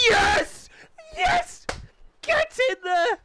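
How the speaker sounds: high, loud yelling celebration; cheering shout. Real, not acted. Commentator cheering his riders into the class. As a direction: shout it out as the bikes line up. Celebrating a race result live.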